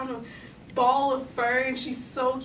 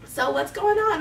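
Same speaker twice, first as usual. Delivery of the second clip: high, loud dispatcher voice; phone operator; 8 semitones higher, 5 dB louder than usual. Retold or imitated. Operator asks the girl about her emergency, role voice. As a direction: put on a higher operator's questioning voice. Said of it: "And this is the operator".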